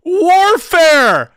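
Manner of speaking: very high, loud yell of disbelief; real outraged outburst; incredulous shout. Real, not acted. Incredulous at claim in the clip.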